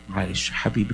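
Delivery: put-on soft, gentle consoling of a child; tender comfort. God tenderly comforting a hurting believer, soft and gentle. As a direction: soothe him like a loving father.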